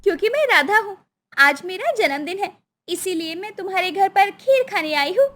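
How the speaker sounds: put-on high, friendly child voice; little girl Radha, sweet. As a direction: tell your new playmate sweetly.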